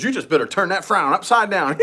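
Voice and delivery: giggly preachy waitress. Retold or imitated. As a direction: imitate her giggling advice. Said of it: "And she goes".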